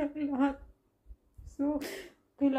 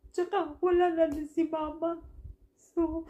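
fighting back tears, louder; crying; 5 semitones higher, 3 dB louder than usual. Real, not acted. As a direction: voice breaking, high and tearful. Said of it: "naiiyak talaga ako".

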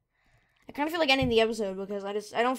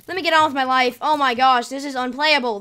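exasperated outburst; 2 semitones higher, 8 dB louder than usual. Real, not acted. Lag ruins the game.

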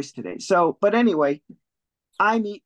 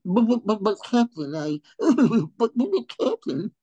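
high, flustered sidekick voice; stammering cartoon pirate mate; nervous first mate; 7 semitones higher than usual. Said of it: "his first mate".

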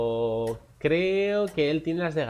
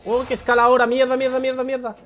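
yelling curses at the game; loud, high panicked swearing; frustrated shouting; 12 semitones higher, 9 dB louder than usual. Real, not acted. Minion scales, he panics.

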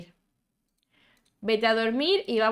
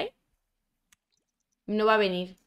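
ordinary delivery

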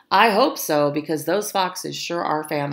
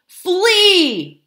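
high, loud barked warning; barked command; 13 semitones higher, 10 dB louder than usual. A narrator's reading. Fox barking to his frightened family to run, loud bark. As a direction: bark it out as an urgent alarm. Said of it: "barked Fred".

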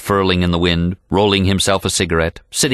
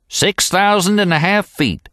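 a touch higher, easy cowboy answer; lightly voiced old guide; 12 semitones higher than usual. A narrator's reading. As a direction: answer offhand.

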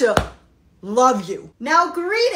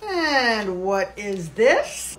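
slow suspicious officer; 5 semitones lower, much slower than usual. Acted. Officer finds powder.

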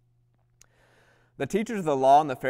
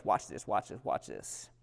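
hushed build-up; 8 semitones lower, 9 dB quieter than usual. Acted. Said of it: "sermon build-up".

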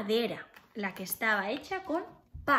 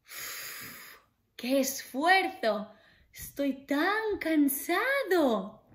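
tired sigh; 4 semitones higher, slower than usual. Acted. Pig worn out from building.

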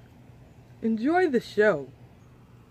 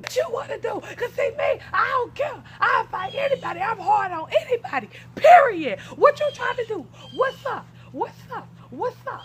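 fight taunt; 10 semitones higher than usual. Acted. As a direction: square up and dare her. Character picks a fight.

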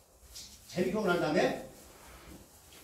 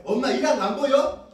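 mother snapping back louder, higher; sharp raised retort; irritated mother; 7 semitones higher, 6 dB louder than usual. Retold or imitated. 아들에게 짜증내는 엄마, 높고 큰 목소리. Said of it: "그럼 엄마는 뭐라 그래요?".

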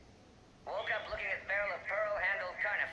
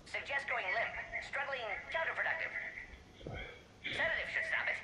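much higher-pitched, mildly alarmed instructions; urgent advice; 8 semitones higher than usual. Acted. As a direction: advise urgently as the demonstration goes wrong. Demonstration animal attacks the puppet.

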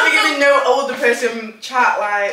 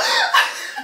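high laughing imitated gasp; mock fan gasp. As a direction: gasp like a stunned fan, hammed-up. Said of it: "They're just like".

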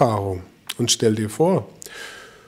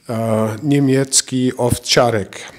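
ordinary talk, nothing marked

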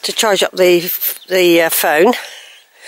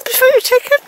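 pet talk; 14 semitones higher, faster than usual. Real, not acted. Talking to the cat, very high baby voice. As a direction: coo at the cat.

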